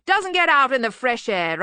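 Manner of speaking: loud, high, hearty remark; hearty bluster. Acted. Arch-Chancellor commenting on the bursar, loud bluster. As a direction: declare it with bluff volume.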